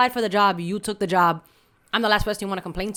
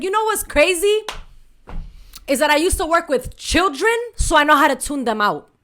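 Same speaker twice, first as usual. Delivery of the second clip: high, louder sarcastic jab; shady comeback; 6 semitones higher, 3 dB louder than usual. Retold or imitated. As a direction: throw shade sweetly but loud. Shading coworker as a child.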